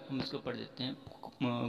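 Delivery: normal talking voice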